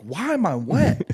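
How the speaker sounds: drawn out like baffled disbelief; puzzled question. Retold or imitated. Waking up soaked, asking slowly in high confusion.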